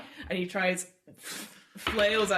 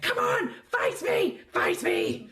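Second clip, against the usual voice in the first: much higher, louder, slow taunting challenge; taunting fighter; 13 semitones higher, 6 dB louder, much slower than usual. Acted. Kobold fighter taunting the dwarf in the ring, high loud goading. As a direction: goad him into fighting back, bouncing on your toes. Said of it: "And he's like".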